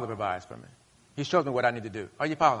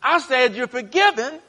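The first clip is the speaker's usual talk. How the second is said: much higher and louder insistent cry; soaring pulpit voice as God; impassioned preaching; 13 semitones higher, 7 dB louder than usual. Acted. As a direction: press the point home with full force. Preacher voicing God, escalating.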